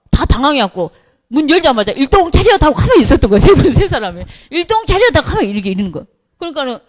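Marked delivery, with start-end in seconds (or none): 1.9-2.6 mock attention call. Frozen members at bar door. 4.5-5.1 mock attention call. Frozen members at bar door.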